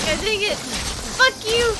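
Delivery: high, loud cursing; frustrated outburst. Real, not acted. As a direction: yell curses at the game enemy. Swearing at the game enemy.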